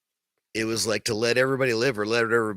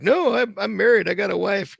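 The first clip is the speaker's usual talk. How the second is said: much higher, louder mocking whine; whiny protest; 8 semitones higher, 4 dB louder than usual. Podcaster mocking the actor's defensive excuse in a high whine. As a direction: squeak it out like a defensive wimp. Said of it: "And you're like".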